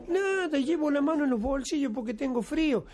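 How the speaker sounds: respuesta aguda del jorobado; jorobado interpelado. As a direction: excusarse con la voz muy subida. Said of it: "Clavó los frenos y joroba". (jorobado dando excusas a la voz, actuado)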